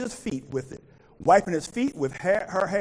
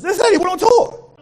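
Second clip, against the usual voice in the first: high, loud preaching call; shouting the line up high; 10 semitones higher, 10 dB louder than usual. Real, not acted. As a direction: belt it out to the congregation.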